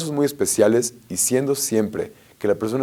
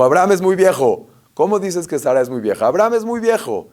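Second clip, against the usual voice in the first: high, louder mock protest; raised-voice retort in a retelling; emphatic retold correction; 7 semitones higher, 4 dB louder than usual. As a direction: exclaim it as an insistent objection, pitched up. Acted correction of a retold quote.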